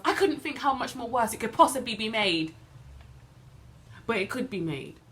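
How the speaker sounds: high, loud, driving outburst; heated, loud indignation at rough treatment; outraged. Acted. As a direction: raise the voice in protest and keep pushing. Outraged retelling of a forceful arrest.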